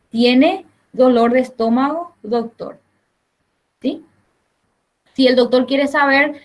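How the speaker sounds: usual speaking voice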